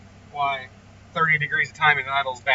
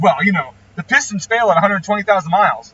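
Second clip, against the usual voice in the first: higher, louder mock lecture; mocking the critic; 4 semitones higher, 6 dB louder than usual. Tuner quotes his online critic's argument, mocking. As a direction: imitate a pedantic objection.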